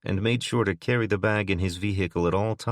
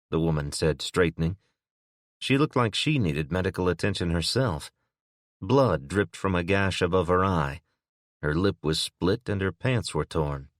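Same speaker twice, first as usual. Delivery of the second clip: ordinary talk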